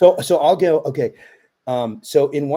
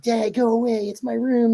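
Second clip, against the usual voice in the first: much higher mock daughter protest; daughter's voice; 10 semitones higher than usual. Dad imagining his twelve-year-old's reply, high mock protest. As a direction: imitate a kid shooing dad off, high-pitched. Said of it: "thought she was gonna be like".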